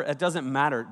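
ordinary talk, nothing marked

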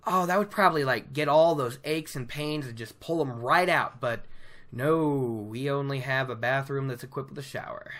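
normal talking voice

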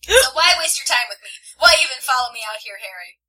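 loud questioning that falls away; heated acted retort; angry challenge. A narrator's reading. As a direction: challenge them, easing off on the name. Tessa challenges Harry in quarrel.

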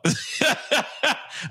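high burst of giggling; laughing at oneself. Real, not acted. Streamer embarrassed by own accent, high laughter. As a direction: crack up, high-pitched.

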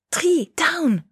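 loud, high warning cry; two sharp high shouted words; urgent shout. A narrator's reading. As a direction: yell to save a friend. Shouted warning as guns fire.

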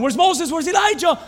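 loud, very high-pitched cries; frantic searching. Acted. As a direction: call out in alarm for missing companions. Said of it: "He opens up his eyes".

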